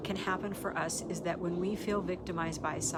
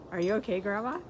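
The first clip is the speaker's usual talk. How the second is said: lightly voiced grandson, higher-pitched end and louder; grandson's concerned question; 3 semitones higher, 6 dB louder, faster than usual. Grandson asks Grandma after she gets in the car, lightly imitated concern. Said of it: "my grandson says".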